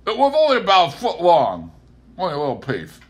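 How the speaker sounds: Pa still grumbling after they leave; Pa muttering. A narrator's reading. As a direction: grumble it to himself. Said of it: "We left Pa still muttering".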